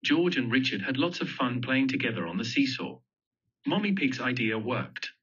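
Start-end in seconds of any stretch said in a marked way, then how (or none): none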